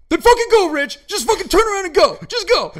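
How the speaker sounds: loud, high fed-up yell; exasperated yell. Retold or imitated. A friend yelling at Rich to just go home, as an exasperated yell. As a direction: blow up at him to just leave. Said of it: "you're like".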